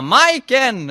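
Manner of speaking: loud, high shout of a name; calling out. Acted. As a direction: call out to someone across the way. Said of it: "Så han ropar".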